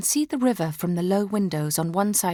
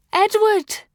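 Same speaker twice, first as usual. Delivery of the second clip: a high, loud, desperate cry of the name; calling out in sleep; 13 semitones higher, 7 dB louder than usual. A narrator's reading. Dreaming queen crying after her husband, high and loud. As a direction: call him back across the sea. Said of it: "call for him in my sleep".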